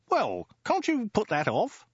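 very high, drawn-out disbelief; high incredulous question; astonished exclamation. Acted. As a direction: exclaim it slowly, pitched right up. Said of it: "he exclaimed".

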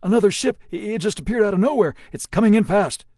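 loud, high and fast panic; panicked shout. A narrator's reading. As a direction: blurt it out in alarm. Pyle panics at new ship.

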